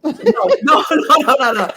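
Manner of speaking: high, laughing string of no's; refusing play fights, laughing high. Real, not acted. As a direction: laugh through repeated no's.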